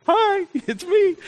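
high, drawn-out extrovert greeting; Mister Extrovert. Retold or imitated. Pastor mimicking his outgoing friend's greeting, high extrovert voice. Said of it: "he's like Mister Extrovert".

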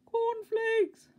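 very high, strained loud squeak; shrieky attempt to be heard; loudest whisper. A narrator's reading. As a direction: squeak it out as loud as a tiny voice can. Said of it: "tried his loudest whisper".

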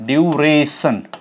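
"duration" said slowly, stress on the second syllable; slow model word. Shown as a demonstration. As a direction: stretch out "duration" for the class. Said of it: "we have certain other words like".

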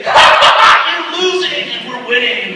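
loud, slow gloating laugh-taunt; mock taunt. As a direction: jeer at the gates of hell. Taunting hell's gates.

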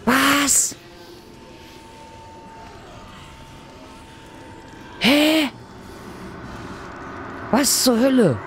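high, startled what; shocked disbelief. Real, not acted. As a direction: yelp of disbelief. Shocked by sudden fall.

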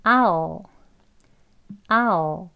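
"아오", I'll without the ee glide; reduced I'll said as "아오"; one step reduced. Shown as a demonstration. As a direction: model "아오" as ah plus dark l. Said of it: "E도 줄여서 그냥 아오".

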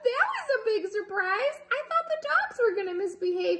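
fast, high, laughing talk; high fast giggly talk. Real, not acted. Laughing after surprise.

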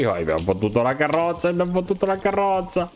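rising giddy triumph; gleeful. Real, not acted. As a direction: crow over the stolen carriage. Gleeful over stealing the carriage. (trionfo euforico che sale di tono)

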